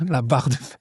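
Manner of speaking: soft, exaggerated Jamel Debbouze accent; quoting Jamel Debbouze's sketch accent. Shown as a demonstration. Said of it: "j'ai voulu faire l'accent de, du sketch de, de Jamel".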